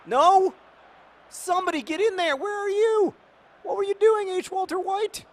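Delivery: exasperated yelling. Real, not acted. Yelling at his player.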